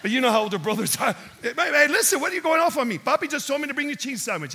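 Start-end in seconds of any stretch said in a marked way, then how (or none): 1.4-4.5 high-pitched protest to a big brother; indignant sibling comeback; defensive little brother. Acted. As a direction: push back, worked up and higher. Acts David protesting to older brother.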